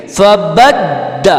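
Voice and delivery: فبد very slow, دال jolted; jolted dal. Shown as a demonstration. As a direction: hit the دال in فبد with a jolt. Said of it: "دال کو ہم جھٹکا دیں گے". (فبد، دال پہ جھٹکا)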